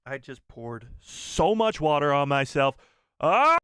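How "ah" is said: sudden high loud yelp; startled yelp. Real, not acted. Streamer yelps as water spills on him. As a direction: yelp as cold water hits.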